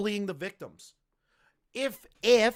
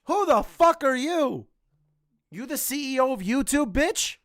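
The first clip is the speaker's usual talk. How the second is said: high, raised-voice challenge; indignant outburst; 9 semitones higher, 4 dB louder than usual. Real, not acted. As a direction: confront him in outraged disbelief. Indignant challenge to the absent YouTuber.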